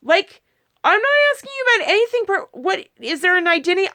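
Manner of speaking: high, fast flustered backpedaling; a high, rushed, startled denial. Retold or imitated. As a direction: talk fast and high, caught off guard. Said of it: "And I was like".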